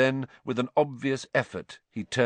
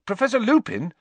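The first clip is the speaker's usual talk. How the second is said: loud, high, cutting in; loud interruption; 12 semitones higher, 6 dB louder, faster than usual. A narrator's reading. Harry cuts in loudly on Lupin, loud and high. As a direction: break in loudly on the teacher. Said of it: "Harry interrupted loudly".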